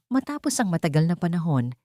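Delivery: ordinary delivery, nothing marked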